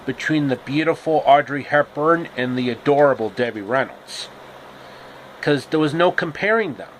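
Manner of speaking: usual speaking voice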